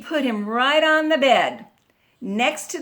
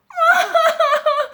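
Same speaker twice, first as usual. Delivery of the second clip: very loud, high mock wail; little girl crying; 7 dB louder than usual. A narrator's reading. Little girl cries in the squeaky bed, loud mock wail. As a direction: burst into a pulsing storybook bawl. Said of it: "answered the girl".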